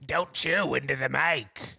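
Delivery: slow, eerie mocking voice; haunting voice. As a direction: mimic him like a creepy demon. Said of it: "my demon is mocking you".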